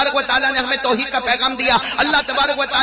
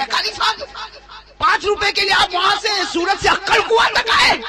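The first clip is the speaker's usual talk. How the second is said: Rashid Khan mocking; 5 semitones higher than usual. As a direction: shout it as the rich man teasing the qari over five rupees. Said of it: "Rashid Khan کیا کہے گا؟".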